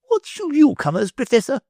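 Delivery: high, pointed noblewoman question; grand lady voice. A narrator's reading.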